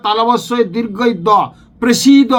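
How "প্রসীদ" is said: প্রসীদ said slowly and a little louder; clear pronunciation. Shown as a demonstration. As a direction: stress both syllables of প্রসীদ. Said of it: "প্রসীদ দুবার হবে".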